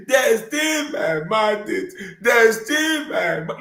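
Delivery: high, drawn-out mock lament; mocking woman-like voice. Commentator mimicking a politician's complaint, mocking woman-like voice. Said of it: "you get Ogbolisa voice".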